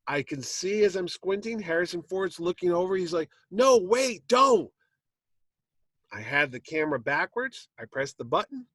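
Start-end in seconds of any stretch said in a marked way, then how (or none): 3.5-4.7 high, loud imitation of a panicked warning; alarmed shout. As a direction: act out shouting to stop someone. Alarmed Ford trying to stop him.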